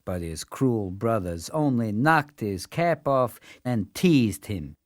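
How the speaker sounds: plain reading voice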